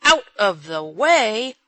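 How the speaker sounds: slow, loud, angry roar; irascible roar. A narrator's reading. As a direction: roar it back in irritation. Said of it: "roared the old gentleman irascibly".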